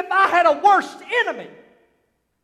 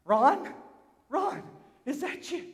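tender wife's reunion; 6 dB quieter than usual. Acted. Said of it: "As she grips her husband".

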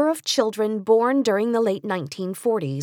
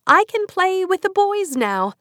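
put-on high, bright-eyed child's boast; little girl reporting her victory to mother; proud little girl; 11 semitones higher, 4 dB louder than usual. A narrator's reading. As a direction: pipe it up like a proud four-year-old.

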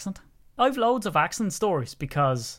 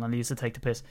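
ordinary delivery

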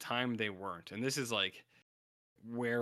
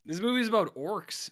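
emphatic answer; 10 semitones higher, 7 dB louder than usual. Retold or imitated. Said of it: "answering own mock question".

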